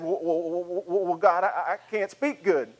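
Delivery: stammering, hesitant objection; Moses. Acted. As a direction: stumble and repeat words as an unsure speaker. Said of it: "And Moses said".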